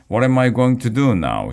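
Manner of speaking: a loud, high, frantic wail; panicked shout. A narrator's reading. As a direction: cry it out in panic. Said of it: "shouted Miss Jojo".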